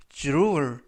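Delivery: "drawer" with a j-like dr onset; DR said as "juru". Shown as a demonstration. Teacher recapping the DR rule, drawer with j-like onset. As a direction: say "drawer" as JROO-er. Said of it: "이라고 발음하시면 되겠습니다".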